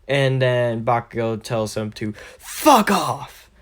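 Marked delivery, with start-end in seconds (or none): 2.4-3.5 higher, mock-angry outburst; angry rebuff. Bakugo tells Deku to go away, angry high rebuff. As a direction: push it up high like an irritated bully.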